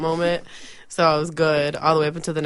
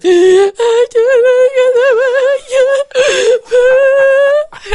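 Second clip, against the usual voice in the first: high, slow, stretched crying wail; wailing mom; 13 dB louder, slower than usual. Retold or imitated. Daughter mimics her mom wailing on the phone, high crying. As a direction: imitate mom sobbing out the words, hammed-up. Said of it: "all I hear is".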